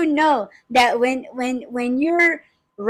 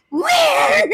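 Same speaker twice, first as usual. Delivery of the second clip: high, loud imitating alarm; panicked where; 13 semitones higher, 3 dB louder than usual. Wife mimicking husband frantically looking around, high and loud. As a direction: mimic him frantically searching.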